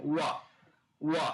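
"ওয়া" glided out of rounded lips; rounded w demo. Shown as a demonstration. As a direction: say each short "ওয়া" apart, lips rounded first. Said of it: "দুই ঠোঁট হচ্ছে গোল". (ঠোঁট গোল করে "ওয়া" আলাদা করে বলা)

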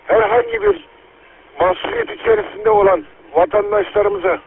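ordinary talk

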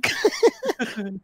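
ince, kopan bir gülüş; kahkahayı tiz patlat. Real, not acted. Arkadaşına gülüyor. (tiz kahkaha)

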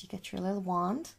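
normal talking voice

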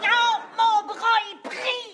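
panicked shout. Acted. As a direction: yell that your arm is stuck. Arm stuck, crying out.

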